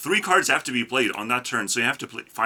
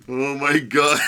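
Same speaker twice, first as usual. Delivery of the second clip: laughing disbelief; 3 semitones higher, 3 dB louder than usual. Real, not acted. Still reacting to the pull.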